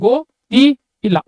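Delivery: gottilla split into go, ti, illa; gottilla as written. Shown as a demonstration. Teacher shows learners how it is written, gottilla broken up. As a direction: sound out gottilla piece by piece, with pauses. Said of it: "You read as it is exactly written".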